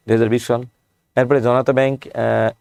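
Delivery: ordinary talk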